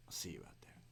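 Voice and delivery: eerie whispered repeat; whispered threat, almost inaudible. Retold or imitated. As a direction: breathe it out in a menacing whisper. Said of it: "and he says".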